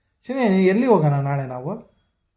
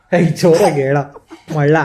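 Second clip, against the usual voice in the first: loud, impatient order; impatient scolding; 7 dB louder than usual. Real, not acted. Parent impatient with child's quiet answers.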